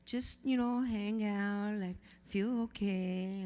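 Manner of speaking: put-on a lightly done heavy Brazilian accent, coaxing; imitating her mother in a Brazilian accent; mom's Brazilian accent. Mom coaxing her teen daughter, in a light Brazilian accent. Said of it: "she's got this heavy Brazilian accent".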